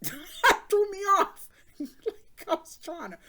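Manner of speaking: giggling in disbelief; laughing. Real, not acted. Laughing at the story.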